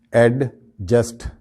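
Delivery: "ایڈجسٹ" slowly split as ad... just; common pronunciation. Shown as a demonstration. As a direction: sound the D in "ایڈجسٹ". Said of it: "پروناؤنس کرتے ہیں". ("ایڈجسٹ" میں ڈی کی واضح آواز)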